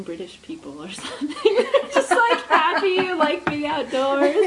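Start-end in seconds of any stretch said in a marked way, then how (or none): 1.4-4.4 high, loud, slow laughing delivery. Real, not acted. Friend laughing while describing happy outdoorsy New Zealanders. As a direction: laugh your way through the description.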